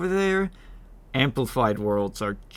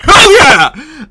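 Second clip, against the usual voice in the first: excited whoop; 17 dB louder than usual. Real, not acted. Skeleton wins the duel.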